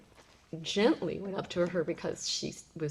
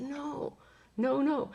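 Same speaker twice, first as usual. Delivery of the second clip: raised-pitch insistent refusal; urgent no; 8 semitones higher, much slower than usual. Retold or imitated. Telling daughter it is no kid, urgent raised no. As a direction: push back urgently, voice jumping up.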